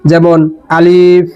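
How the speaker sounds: আলিফ without any drawn-out vowel; quick unstretched আলিফ; short alif, no lengthening. Shown as a demonstration. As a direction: say আলিফ short and clipped, with no stretch on the a. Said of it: "এভাবে কোনো টান হবে না".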